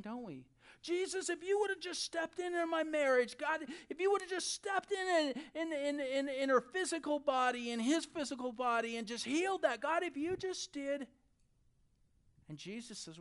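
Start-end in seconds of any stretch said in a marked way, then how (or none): 0.8-11.1 high, loud pleading to God; acted reproachful plea, pushed and urgent; calling out. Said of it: "we call out that same thing".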